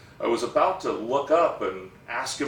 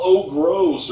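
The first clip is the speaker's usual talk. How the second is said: loud, higher-pitched imitation of a disgusted yell; mock yell; 6 semitones higher, 9 dB louder than usual. Kid reacting to a friend's fart, mock yell. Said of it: "you're gonna yell".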